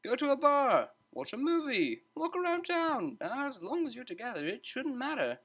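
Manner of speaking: high, bright-toned teacher breaking up a fight; a lively tutor stepping in to calm two students; cheery tutor voice. A narrator's reading. Tutor calming arguing students, high cheerful voice.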